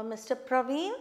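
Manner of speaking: "Praveen" lifted at the end; rising question tune on "Praveen"; questioning rise. Shown as a demonstration. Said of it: "you are asking a question".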